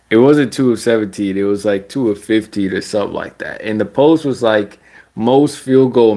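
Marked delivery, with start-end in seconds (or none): none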